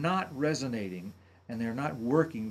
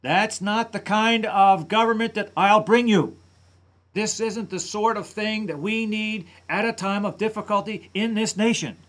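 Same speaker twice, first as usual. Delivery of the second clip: much louder and higher, a forceful campaign-speech shout; imitating angry McCain; 7 semitones higher, 9 dB louder than usual. Analyst imitating McCain's angry downward inflection. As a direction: hammer it out like an angry stump speech. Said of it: "have the sound of anger".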